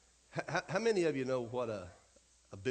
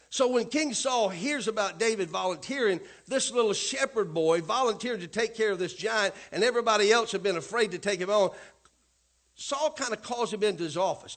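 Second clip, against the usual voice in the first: raised, driving sermon storytelling; preaching; 4 semitones higher, 5 dB louder than usual. Acted. Pastor retelling David to congregation, preaching.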